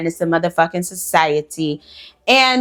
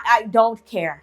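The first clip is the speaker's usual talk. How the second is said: ordinary delivery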